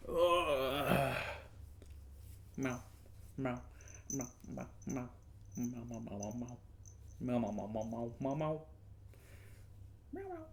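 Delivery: soft mock meows to the cat; meowing softly back at the kitty. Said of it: "meowing at the cat".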